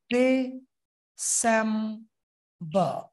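ডিসেম্বর stretched slowly into three syllables; drawn out ডিসেম্বর, beat by beat; syllable-split demo. Shown as a demonstration. Teacher claps ডিসেম্বর syllables. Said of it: "সেম জোরে হবে".

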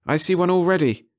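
frightened cry of discovery; alarmed girl. A narrator's reading.

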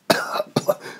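rough coughing fit; spluttering cough. A narrator's reading. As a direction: cough out some water. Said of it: "cough and splutter".

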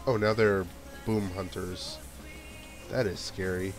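normal talking voice

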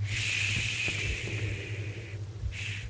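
soft unvoiced shush; quietly shushing in fear. Real, not acted. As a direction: hush to listen. Listening for footsteps.

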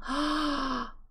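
delighted gasp. Real, not acted. Player delighted by a new trait, gasping. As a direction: draw in a long thrilled breath.

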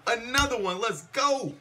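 high, loud hype chant; excited shout. Real, not acted. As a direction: shout it at the screen like a fan. Hype chant while watching game.

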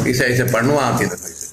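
usual speaking voice

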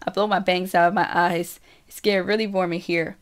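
plain reading voice

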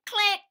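high loud click noise; camera click sound. Pretend. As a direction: snap a click.

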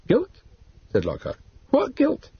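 puzzled surprise. A narrator's reading. Lockhart puzzled question.

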